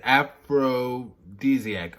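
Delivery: "Aphrodisiac" stretched out slowly; slow, deliberate "Aphrodisiac"; drawn-out pun. Shown as a demonstration. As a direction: break "Aphrodisiac" into slow parts to show the pun. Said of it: "No pun intended".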